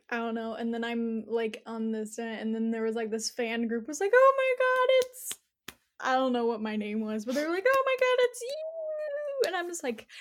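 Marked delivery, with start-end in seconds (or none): 4.1-5.3 high, loud fangirl squeal; excited fans. Retold or imitated. Fan group spots the hero, squealing high and loud. 7.7-9.4 excited fans. Retold or imitated. Fans recognize her.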